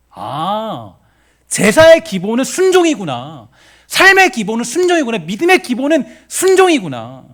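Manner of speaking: loud, high cry of realization; preaching proclamation. Real, not acted. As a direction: proclaim each phrase at full force, high and drawn out. 깨달음을 크게 선포하는 설교. (깨달음을 힘껏 외치는 설교조)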